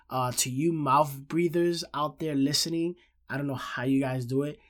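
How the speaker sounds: normal talking voice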